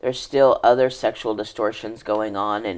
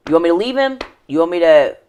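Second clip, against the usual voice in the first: higher, louder, pressing questions; agitated mother; 6 semitones higher, 8 dB louder, much faster than usual. Retold or imitated. Mother confronting her child in the kitchen, agitated. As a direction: throw frantic demands, imitating an upset mom. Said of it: "sweeping so aggressively, and she's like".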